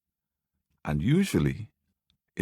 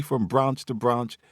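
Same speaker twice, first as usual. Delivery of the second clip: ordinary delivery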